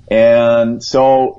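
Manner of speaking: ordinary talk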